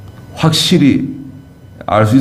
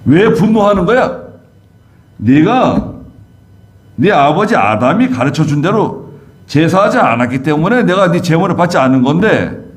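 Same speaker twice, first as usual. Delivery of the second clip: higher-pitched, exasperated scolding; God rebuking; 6 semitones higher than usual. Acted. God takes Cain to task over his anger, rebuking. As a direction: scold him like an exasperated parent. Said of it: "하나님께서 책망하셨어요".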